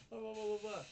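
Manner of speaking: high, loud blah-blah imitation; mock ranting. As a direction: parody her blaming rant. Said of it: "accountable thing".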